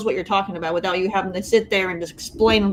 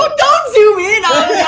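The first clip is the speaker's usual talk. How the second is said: high, loud squeal through laughter; real embarrassed laughing shout; laughing protest; 10 semitones higher, 9 dB louder than usual. Real, not acted. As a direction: plead loudly while cracking up. Laughing protest as host zooms in.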